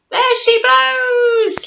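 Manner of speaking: high, loud, slow jolly pirate cry; hammed-up pirate shout as the whale spouts; laughing captain. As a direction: bellow it out with a laugh. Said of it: "laughs the captain".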